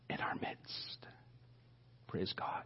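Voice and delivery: hushed, breathy whisper to the church. Real, not acted. Preacher whispering to the church about mission. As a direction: drop to a whisper for awe.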